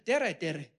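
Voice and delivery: "Tere, tere" said TEH-reh with tapped r, falling; Estonian hello. Shown as a demonstration. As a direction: greet in Estonian, "Tere" with a rolled r. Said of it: "That's how they say hello".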